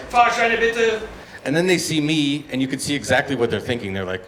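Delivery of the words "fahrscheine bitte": higher, officious inspector voice; ticket inspector call. Retold or imitated.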